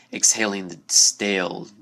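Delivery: ordinary delivery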